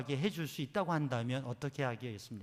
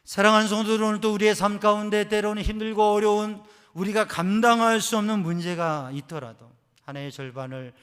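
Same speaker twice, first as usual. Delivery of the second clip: loud, higher appeal; preaching at full force; 7 semitones higher, 15 dB louder than usual. Acted. Preacher calling to his beloved congregation, loud. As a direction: call out to the congregation.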